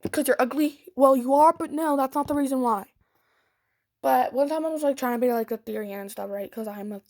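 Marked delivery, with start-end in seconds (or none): 0.9-1.5 much louder, cheeky insult; loud jab. Real, not acted. Insulting the girl she's fighting with, loud jab.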